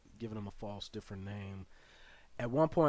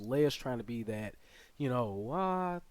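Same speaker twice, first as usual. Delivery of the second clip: ordinary delivery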